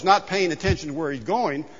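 normal talking voice

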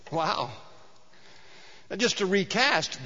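ordinary delivery

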